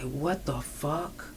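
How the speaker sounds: slow, hushed horror; shocked, slow hush. Retold or imitated. Friend seeing her bloody body, hushed and shaken. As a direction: breathe it out, appalled by the blood. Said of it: "He immediately started crying".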